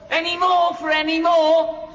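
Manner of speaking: high, carrying tram conductor call; conductor's call. Acted. [name] mocking the tram conductor's call. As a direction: sing it out to the passengers.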